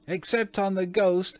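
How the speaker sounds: put-on very high shrieking child voice; shrieked. A narrator's reading. Twins capering about, shrieking gleefully. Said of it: "shrieked the twins".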